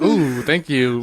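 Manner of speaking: very slow, drawn-out put-on thanks; hammed-up meek gratitude; mock grateful. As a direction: coo a thank-you to the mugger. His own mock grateful reply.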